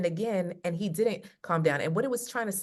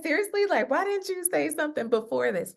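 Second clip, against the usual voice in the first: mock exasperation; 9 semitones higher than usual. Husband exasperated, she excuses herself.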